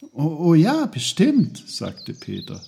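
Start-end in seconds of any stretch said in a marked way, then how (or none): 0.1-1.6 put-on high, loud child's agreement; eager boy. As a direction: agree brightly in a high boy's voice.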